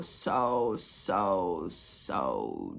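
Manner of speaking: dragged down low. Real, not acted. Ranting about the show, voice sinking deep and slow.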